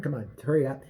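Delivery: usual speaking voice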